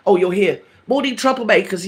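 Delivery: ordinary delivery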